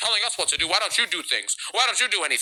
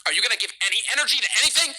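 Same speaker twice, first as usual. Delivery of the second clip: ordinary talk, nothing marked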